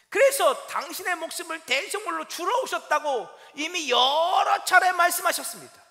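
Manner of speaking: preacher's slow, forceful climax; emphatic preaching. Acted. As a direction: proclaim it slowly and forcefully.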